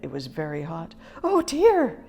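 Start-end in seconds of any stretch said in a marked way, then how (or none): 1.2-2.0 worried. A narrator's reading. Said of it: "she said".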